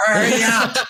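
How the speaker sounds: high-pitched mock yell of a slave-driving wrangler; hammed-up, high herding call; bossy wrangler. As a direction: imitate a taskmaster hurrying everyone along. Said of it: "didn't have a whip in her hand".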